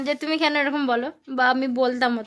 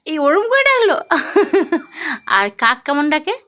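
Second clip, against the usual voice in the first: put-on bright, loud sing-song quizzing of a toddler; loud child-directed teaching; 4 semitones higher, 7 dB louder than usual. Mother quizzing her toddler on letters, in a loud sing-song. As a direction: coax the little one through letters and numbers, loud and eager.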